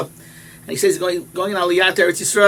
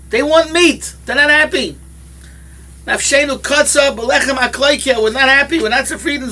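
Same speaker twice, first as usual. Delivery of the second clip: mock kvetch; 7 semitones higher, 4 dB louder than usual. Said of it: "two said".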